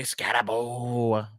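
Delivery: slow, drawn-out comic impression; Adam Sandler. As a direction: badly imitate a comedian, stretching the name out. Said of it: "Terrible Adam Sandler impression".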